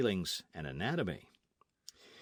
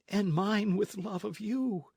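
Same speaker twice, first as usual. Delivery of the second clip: much higher, slow wounded voice; hurt young lady; 11 semitones higher, slower than usual. Acted. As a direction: let the rejection sting. Lolita answers.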